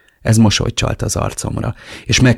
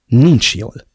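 short, clipped-off answer; curt reply; 3 semitones higher, 6 dB louder than usual. Acted. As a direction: cut it short, no warmth. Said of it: "felelte kurtán". (kurtán)

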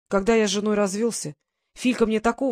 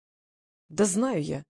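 ordinary delivery, nothing marked

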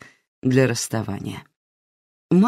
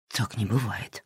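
quiet thought; 5 semitones lower, 10 dB quieter than usual. Acted. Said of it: "подумал Макс".

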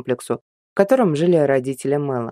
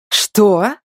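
sharp, loud, higher enraged hiss; furious hiss; 6 semitones higher, 5 dB louder than usual. Acted. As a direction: spit the word out in fury. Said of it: "зашипела взбешённая Чаролита изменённым голосом".

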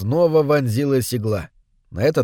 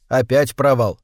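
usual speaking voice

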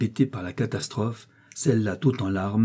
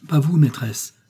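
raised, louder protest; appalled; 5 semitones higher, 6 dB louder than usual. A narrator's reading. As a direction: object in dismay. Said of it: "épouvanté de son calme".

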